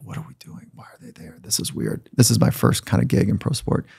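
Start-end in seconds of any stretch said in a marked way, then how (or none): 0.0-2.1 hushed, whispered unease; whispered thought. Retold or imitated. New staffer thinking to himself in coach's office, uneasy whisper. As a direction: whisper it privately to yourself.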